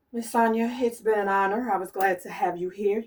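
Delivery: ordinary talk, nothing marked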